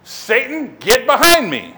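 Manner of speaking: loud, confrontational command; stern rebuke. Retold or imitated. Jesus turns on Peter in front of everybody, stern and loud. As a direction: rebuke him sternly, punching it out. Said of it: "He probably says it in a stern voice".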